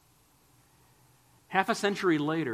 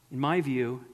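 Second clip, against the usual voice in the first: catch in voice; 5 dB louder than usual. Retold or imitated. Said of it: "a catch in his voice".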